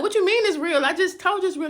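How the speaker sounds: high, loud, impatient; not getting the hint; exasperated. Retold or imitated. Daughter confused by her friend, exasperated.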